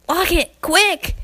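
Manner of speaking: high, loud urgent call. Acted. Scootaloo calling to friends to lock the door, urgently. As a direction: bark it out to bolt the door. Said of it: "Scootaloo called out".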